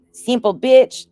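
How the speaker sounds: high, loud shout of the slogan; proud loud catchphrase. Acted. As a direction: belt it out like a rally cry.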